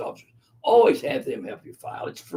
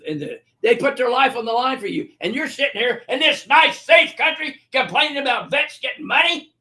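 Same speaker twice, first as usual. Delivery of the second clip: angry retort; 4 semitones higher than usual. Retold or imitated. Retelling how he told off the barbershop complainer, furious. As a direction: tear into him, boiling over. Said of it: "just fuming, just getting madder".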